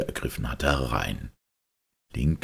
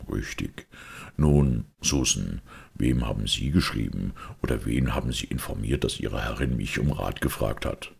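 Holmes; 4 semitones lower than usual. Acted. Detective questions Susan about informing someone, low and deliberate.

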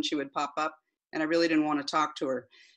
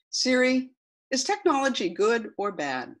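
repeating to voice assistant; 5 semitones higher than usual. Acted. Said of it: "this is the transcript of that conversation".

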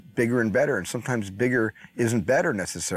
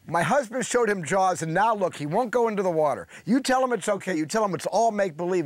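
imitated scolding mother, pitched high; high, insistent mock telling-off; giving a piece of her mind; 9 semitones higher than usual. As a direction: retell her indignant New York tirade. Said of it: "she gave me such a piece of her mind".

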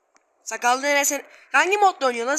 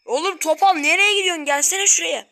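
higher, louder call; 6 semitones higher, 5 dB louder than usual. Real, not acted. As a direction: shout across at him. Calling out at character.

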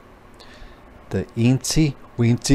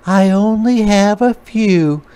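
higher, louder spider voice; little spider; 10 semitones higher, 4 dB louder than usual. A narrator's reading. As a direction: answer mama simply. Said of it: "said the eensy weensy spider".

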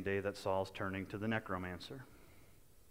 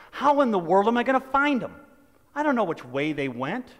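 much higher and louder, a frantic cry; panicked father; 10 semitones higher, 12 dB louder than usual. Acted. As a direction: cry out like a father who has lost his children. Man imagines losing his kids.

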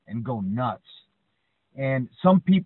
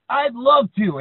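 much higher, louder eager wrestler voice; 11 semitones higher, 7 dB louder, slower than usual. Retold or imitated. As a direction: relish the fight. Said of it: "He's like".

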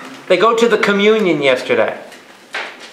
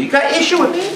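very high, mock-indignant question; hammed-up disbelief, high pitch; incredulous retort; 10 semitones higher, much faster than usual. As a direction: throw it back, voice shooting up. Acts priest's startled reply.

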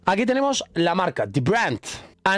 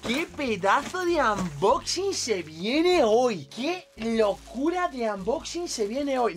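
hype; 5 semitones higher, slower than usual. Acted. Hyping the unboxing to viewers.

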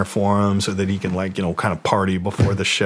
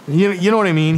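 higher, louder friendly Tommy voice; imitating Tommy; 9 semitones higher, 4 dB louder, faster than usual. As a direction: toss it out like the welcoming host. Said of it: "He'll be like".

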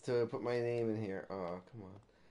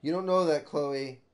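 loud retort; 4 semitones higher, 8 dB louder than usual. Real, not acted.

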